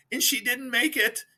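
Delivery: high, fake-sobbing line; mock crying husband. Husband on the news cameras, mock crying. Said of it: "And then he goes".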